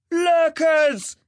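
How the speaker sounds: long, loud, high warning call; lookout's bellow. A narrator's reading. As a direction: bellow the alarm from a watchtower. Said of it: "he bellowed".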